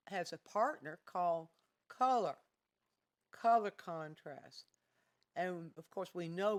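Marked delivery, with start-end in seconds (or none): 1.9-4.6 announcing the key term color; stress on color. Shown as a demonstration. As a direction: hit the word color hard. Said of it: "teacher stresses new term".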